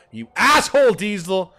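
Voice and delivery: high, loud joking yell at himself; mock shout. Streamer mock-yelling at himself after the French bit. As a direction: bark the insult in jest.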